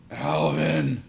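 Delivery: loud, high call of the name; bellow. A narrator's reading. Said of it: "my dad bellowed".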